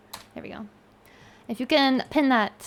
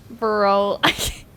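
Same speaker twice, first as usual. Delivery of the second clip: normal talking voice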